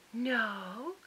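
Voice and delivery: very soft Southern mother; small, innocent Southern denial; sweet Southern voice. Retold or imitated. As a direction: imitate a sugary Southern lady, softly. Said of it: "so sweet butter wouldn't melt in her mouth Southern voice".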